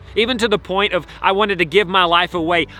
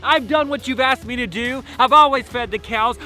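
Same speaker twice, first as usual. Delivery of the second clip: outraged, wounded complaint; angry older brother; 5 semitones higher than usual. Acted. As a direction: pour out the grievance, hitting each accusation. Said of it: "he was full of anger".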